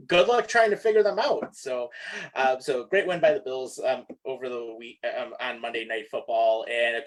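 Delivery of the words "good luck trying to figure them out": higher, louder, laughing taunt; amused taunt. Retold or imitated. Co-host taunts defenses facing exotic formations, laughing and teasing. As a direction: laugh it out as a mock challenge.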